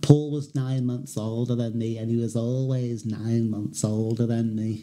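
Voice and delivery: low, slow head-cold Scouse. Acted. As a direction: drone it low and drawn-out, as if bunged up. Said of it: "[name] always sounded like he had a cold".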